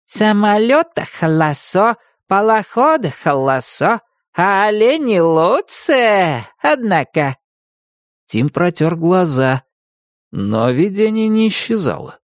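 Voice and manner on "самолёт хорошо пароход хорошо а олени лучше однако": put-on high, slow, cheerful Chukchi accent. A narrator's reading. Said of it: "жизнерадостно напевал себе под нос".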